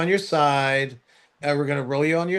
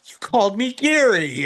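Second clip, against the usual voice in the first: teasing accusation; 8 semitones higher, 3 dB louder than usual. Retold or imitated. Teasing the nurse in elevator.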